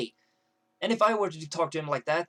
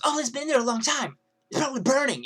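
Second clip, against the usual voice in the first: loud, high mocking whine; bitchy complaint; 8 semitones higher, 6 dB louder than usual. Girl arguing with the kiosk guy, bitchy complaint. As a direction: imitate her pushy griping. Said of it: "decided to say it like that".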